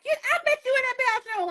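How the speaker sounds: high, fast, playful Whitney voice; [name] impression. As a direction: rattle it off high like Whitney teasing. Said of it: "Now you know how she talked".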